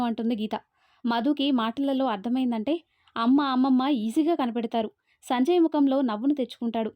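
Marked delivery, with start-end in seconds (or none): none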